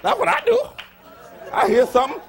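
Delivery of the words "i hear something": ordinary delivery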